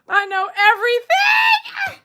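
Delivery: high, slow playful holler; squealed boast. Acted. Streamer boasting to viewers, high drawn-out squeal. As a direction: draw it out high, squealing the last word.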